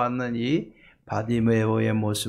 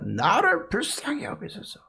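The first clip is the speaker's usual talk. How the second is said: acted cry for mercy, not full volume; 8 semitones higher than usual. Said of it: "소리 지릅니다".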